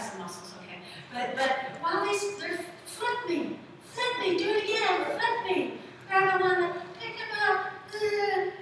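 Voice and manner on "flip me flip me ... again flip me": put-on high-pitched kids clamoring to play; eager begging child. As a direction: beg for it like excited children. Voices kids begging to be flipped.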